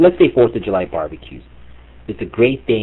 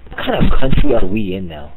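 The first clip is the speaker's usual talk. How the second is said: normal talking voice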